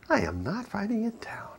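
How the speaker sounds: a hushed whispered refusal. Retold or imitated. Husband repeats refusal in joke.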